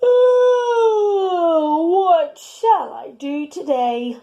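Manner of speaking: lightly voiced dog, stretched high yawny oh; a hint of a just-woken dog yawning; sleepy Dog. Dog asking himself after waking, lightly yawny.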